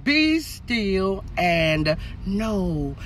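quiet prayerful stillness; hushed and slow. Acted. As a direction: breathe it out gently, almost a whisper. Slow hushed scripture line.

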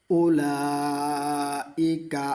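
drawn-out melodic recitation; slow Quran recitation. Shown as a demonstration. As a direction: chant it slowly in tajweed style. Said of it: "একটা করে অক্ষর উচ্চারণ হবে".

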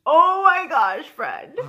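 loud, slow exasperated oh my gosh. Retold or imitated. Exasperated at a friend's sloppy measuring, loud and slow. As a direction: let the disbelief spill out loud.

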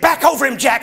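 high loud urgent command; high shouted order; frantic yell. Retold or imitated. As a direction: bark it in a panic. Wife orders in panic.

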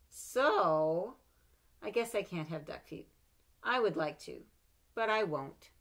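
put-on lively read-aloud for children; story reading. A narrator's reading. Mother reading a picture book to her child, lively.

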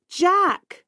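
high-pitched call, no real volume; narrated shout. A narrator's reading. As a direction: call out a name urgently, high. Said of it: "Tonya shouts".

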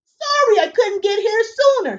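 high, chirpy little fairy man; fairy godfather. Acted. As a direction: squeak it out like a tiny busy fairy.

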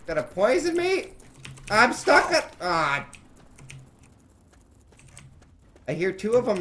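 high, loud panicky shouting; panicked shouting. Real, not acted. Gamer panicking as scorpions attack, loud shouting. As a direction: holler while under attack.

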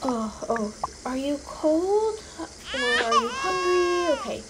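ordinary delivery, nothing marked

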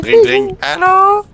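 high squeaky cartoon kid on the phone; switching impression voices. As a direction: pitch way up, play the little boy. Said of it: "la meilleure imitation de Caillou".

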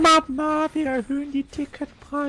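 put-on squeaky child voice. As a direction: squeak it like a little kid. Mock child voice joke.

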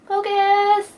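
high, playful plea at the camera; high-pitched command. Real, not acted. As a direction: coax the camera in a high squeak. Calling at the camera to focus.

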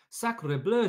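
high, loud French-flavoured exclamation; duck's cry. Acted. Duck spots the nest, loud high cry. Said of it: "she cried".

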